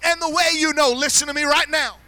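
loud, high, shouted sermon climax; roaring Pentecostal preacher in full flight; preaching at full force. Real, not acted. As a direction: preach it to the rafters with everything.

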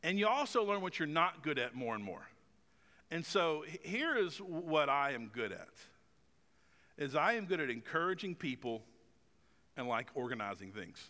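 ordinary talk

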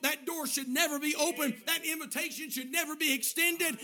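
loud, high, emphatic sermon delivery; full-force preaching. Real, not acted. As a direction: drive each warning home to the congregation.

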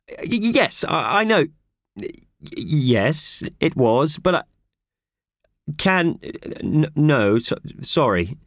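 stammering, fumbling apologies; nervous stammer. A narrator's reading. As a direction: trip over each word, cowed. Howard scolded on the phone.

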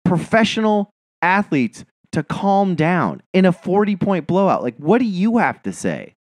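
high-pitched, incredulous indignation; indignant outburst. Retold or imitated. Fan retelling outrage at the assistant coach, indignant. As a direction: demand answers, pitched well up.

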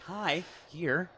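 lightly mimicked eccentric actor; Jeff Goldblum. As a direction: slip into a mannered movie-star greeting. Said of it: "like, a Jeff Goldblum almost".

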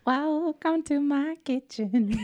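high slow breathy singer impression; indie girl singer voice. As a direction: mimic a quirky indie singer. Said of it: "You know what she sounds like?".

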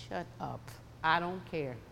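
quiet dismissal. Retold or imitated. Muttered retort to enemy's trash talk.

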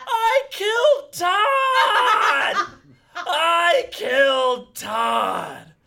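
long, drawn-out mock-anguished screams; hammed-up wailing scream. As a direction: wail it out at full volume, milking the drama. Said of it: "I was putting it on a bit".

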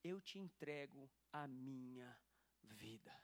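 low, hushed, slow surrender to Jesus; heartfelt prayer. Retold or imitated. Retelling her surrender to Jesus at church, heartfelt low prayer. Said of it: "de todo o meu coração falei".

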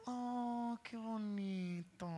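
soft sweet voice. Shown as a demonstration. Contrasting gentle speakers with harsh ones, a soft hush. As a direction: coo it gently, almost breathless. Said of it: "Y otras que hacen".

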